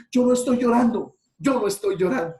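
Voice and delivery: loud, high insistence, fighting tears; hammed-up "no estoy llorando", denying loudly; mock denial of crying. A crying lover denies tears to a friend, loud mock protest.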